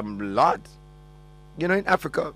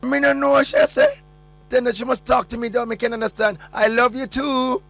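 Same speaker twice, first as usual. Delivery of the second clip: high, loud put-on woman's voice in broken English; mock woman's voice; 10 semitones higher, 4 dB louder than usual. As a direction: play the lady who can't understand, pitched way up.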